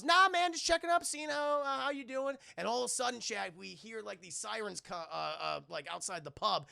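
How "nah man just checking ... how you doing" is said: much higher, loud breezy voice; mock casual Rock. The Rock pretending just to check in, hammed-up breezy. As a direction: fake innocent cheerfulness. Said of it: "And he goes".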